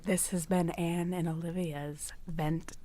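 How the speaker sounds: slow, hushed sign-off whisper. Pretend. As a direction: breathe the goodbye, barely voiced. Podcast sign-off, whispered first take.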